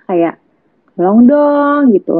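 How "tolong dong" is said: offhand, tossed-off request; just ask casually, no urgency; a mild, relaxed "Tolong dong". Retold or imitated. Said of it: "Intonasi ya".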